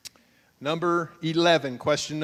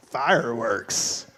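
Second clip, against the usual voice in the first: ordinary talk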